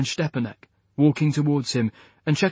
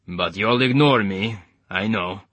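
put-on Eastern European accent; 3 semitones lower than usual. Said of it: "in his gloriously thick Eastern European accent".

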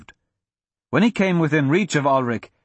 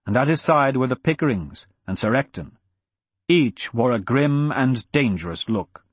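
usual speaking voice

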